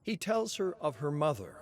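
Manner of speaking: usual speaking voice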